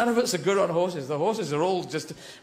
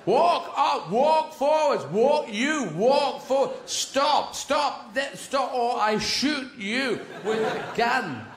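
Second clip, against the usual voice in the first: horse shouter; 6 semitones higher, much slower than usual. Retold or imitated. Horse shouter yelling commands at the horse. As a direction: bark orders at the horse. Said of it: "horse shouters".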